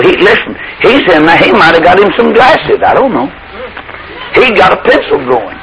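normal talking voice

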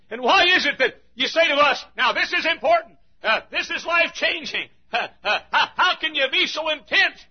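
high, loud laughing imitation of a churchgoer; hammed-up churchgoer, laughing; laughing mock questioner. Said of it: "Somebody said, "Preacher".

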